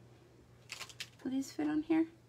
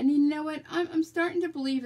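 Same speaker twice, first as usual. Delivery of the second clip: exasperated outburst; 6 semitones higher, 7 dB louder, faster than usual. Real, not acted. Frustrated by frozen video.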